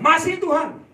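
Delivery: high, loud reply of "Masih, Tuhan"; loud answer to God. Retold or imitated. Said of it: "Saya bilang". (jawaban lantang dan tinggi kepada Tuhan)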